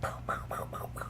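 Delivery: breathy whispered pow pow gun noises; soft imitation of a pistol firing; whispered gunshots. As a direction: mouth the shots under your breath. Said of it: "He goes like this".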